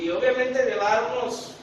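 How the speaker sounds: usual speaking voice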